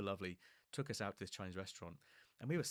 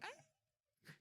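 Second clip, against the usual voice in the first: short high squeal, sharply up then cut off; sudden high yelp; retold squeal; 10 dB quieter than usual. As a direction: yelp as you fall in. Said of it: "I just went squealing".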